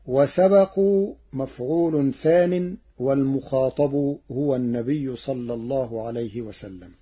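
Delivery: ordinary talk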